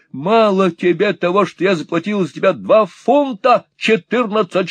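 high, scolding rant; high angry rant at her husband. Acted. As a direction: tear into him, high and furious.

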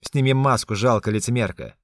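loud, threatening yell; menacing shout. A narrator's reading. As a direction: bark it at her like a threat. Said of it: "кричит грозно". (грозный крик)

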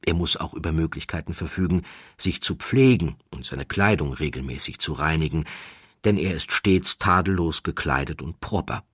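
plain reading voice

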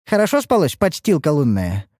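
high-pitched, needling taunt; snide jibe. A narrator's reading. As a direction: toss it out as a biting insult. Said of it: "донеслось язвительное замечание".

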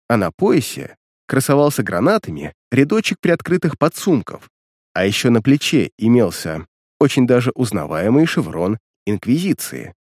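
ordinary delivery

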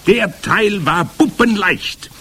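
high, loud, drawn-out witch voice; boastful Grand High Witch. A narrator's reading. Witch leader showing off to her followers, boastful. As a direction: crow slowly over how easy it was.

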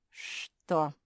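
terse, pressed-out "what"; curt, grudging snarl; through clenched teeth. A narrator's reading. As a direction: squeeze the word through your teeth. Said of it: "процедила мопсишка".

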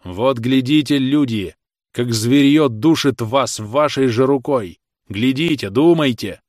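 loud, emphatic appeal to the crowd; weighty words. A narrator's reading. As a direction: drive each word home to the people. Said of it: "бил тяжёлыми верными словами". (весомо, громко, как удары)

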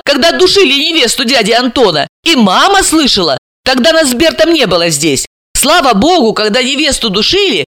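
high, animated character voice; Alisa. Acted. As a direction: play Alisa excitedly filling in details.

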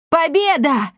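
high, jubilant cat's cry; overjoyed squeal of a cat; happy yell. A narrator's reading. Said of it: "завопил счастливый кот Акаций".

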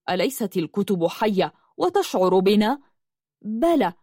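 usual speaking voice

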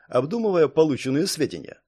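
ordinary delivery

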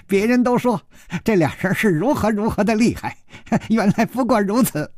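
high, piping giggly villain; shrill, thin childlike voice. A narrator's reading. Stranger scoffing at two beaten fighters, shrill childlike voice. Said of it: "有尖有细有快".

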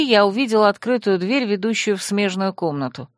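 ordinary delivery

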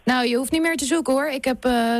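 usual speaking voice

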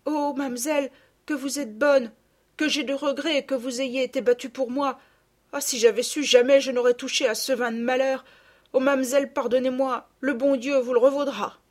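high, plaintive gratitude; remorseful girl begging forgiveness; repentant daughter. A narrator's reading. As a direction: pour out remorse and thanks. Repentant daughter thanks Sophie.

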